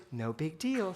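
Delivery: put-on quiet, steady little boy voice; small boy's calm reassurance. Son reassuring mom after blender mess, softly. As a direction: say it like a four-year-old calming mom. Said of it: "looks up to me and goes".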